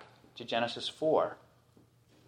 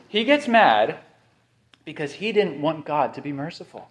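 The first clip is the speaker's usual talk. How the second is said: usual speaking voice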